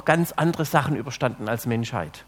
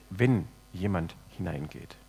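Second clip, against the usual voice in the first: "wenn" set apart and weighted, then a pause; deliberate emphasis on the first word, "wenn"; stress on "wenn"; 9 semitones lower, 6 dB quieter than usual. Shown as a demonstration. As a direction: lean on "wenn", let the rest fall away low. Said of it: "Ich lege einmal die Betonung auf das erste".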